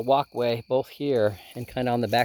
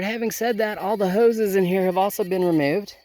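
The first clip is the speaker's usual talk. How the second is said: ordinary delivery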